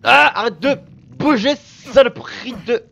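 loud, high, stretched curse; roaring at the dodging enemy; angry yell. Real, not acted. Gamer yelling at a wriggling monster.

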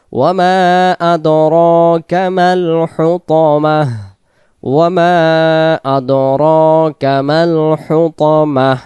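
slow chanted recitation; Quran recitation. Shown as a demonstration. Teacher chants the verse for students after spelling it. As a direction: draw out the vowels like tajweed.

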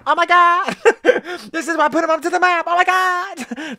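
hammed-up high fanboy voice; Steve impression. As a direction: squeal it like an overexcited fan, then stretch the last oh my God. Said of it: "That's Steve".

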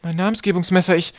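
high, alarmed exclamation; calling out. A narrator's reading. Twig misses his naming knife, calling out. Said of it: "rief er".

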